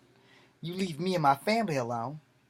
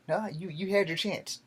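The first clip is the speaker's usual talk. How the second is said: usual speaking voice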